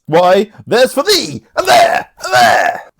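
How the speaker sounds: loud, high, punched exclamations; hammed-up stage combat yell; theatrical fighting cry. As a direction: shout each blow as it lands. Performs Sebastian's blows from the play.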